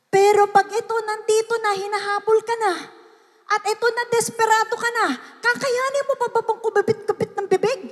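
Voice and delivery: high, insistent pulpit delivery; urgent preaching. Real, not acted. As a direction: build urgency toward a desperate cry. Preaches desperate plight. (apurado at mataas na boses ng nangangaral)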